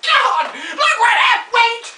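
high, hammed-up alarm; theatrical panic. Old star dropping the ladder, mock panic.

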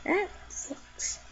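unvoiced whispered aside; soft whispered comment. Real, not acted. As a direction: breathe it as a secret.

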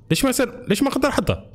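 frustrated. Real, not acted. Item won't fit, frustrated.

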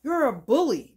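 put-on a whiny kid, pushed out loud at the start; child's complaint. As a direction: speak as a child protesting to a bigger adult. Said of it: "That's what children be wanting to say".

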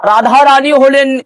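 high, sustained devotional intoning; preaching. Acted. Guru teaching of Radha Rani's grace, intoned preaching.

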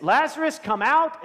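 commanding call. Retold or imitated. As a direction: call him out of the tomb. Jesus calls Lazarus from tomb.

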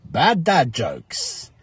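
drawn-out title announcement; slow show title. Acted. Title announcement of each joke.

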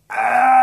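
very long, high, loud cartoon shriek; prolonged shriek. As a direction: shriek like a dying giraffe riding a lawnmower. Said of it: "you just hear him shriek like".

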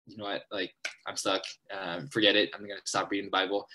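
lightly voiced giving up, low and soft; discouraged reader. Imagined reader stuck in Leviticus, discouraged. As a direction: mutter it like a mildly defeated reader.

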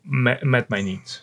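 ordinary delivery

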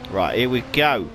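usual speaking voice

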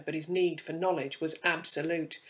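ordinary delivery

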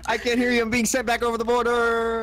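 high, loud mock cry for help; mock fading voice. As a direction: wail as if dragged away. Joke about deportation.

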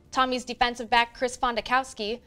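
ordinary talk, nothing marked

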